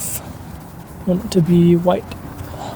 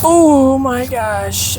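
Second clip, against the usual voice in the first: higher and slowly drawn out; awed oh my gosh; 6 semitones higher, much slower than usual. Real, not acted. Seeing the finished headlight, slowly and higher in awe. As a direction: let the amazement stretch the words.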